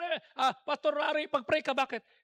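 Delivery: usual speaking voice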